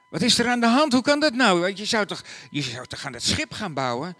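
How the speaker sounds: high, loud astonished questions; can't believe he's home; Mary surprised at Joseph's early return. Acted.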